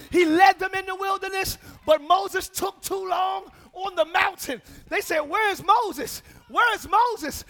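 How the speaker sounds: loud, rousing sermon voice; fiery preaching. Real, not acted. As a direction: preach it out to the congregation. Preaching at full force.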